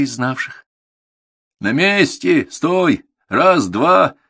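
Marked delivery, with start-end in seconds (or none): 1.6-4.2 high, loud parade-ground orders; angry drill command. A narrator's reading. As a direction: snap out each order with force. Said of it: "зло скомандовал Контуженный".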